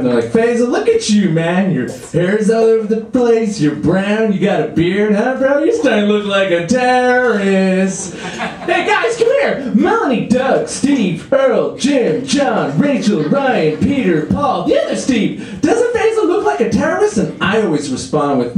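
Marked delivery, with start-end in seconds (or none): 0.3-8.1 high-pitched teasing buddy; mock a pal's looks in a raised voice; excitable bro voice, pitched up. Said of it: "they come up to me". 8.2-17.2 high-pitched holler to a crowd of pals; excited, high calling-out voice; calling friends over. Acted.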